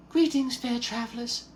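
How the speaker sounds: high, soft fairy voice; pixie. Acted. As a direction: float it out like a tiny helpful pixie. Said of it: "and it begins to speak".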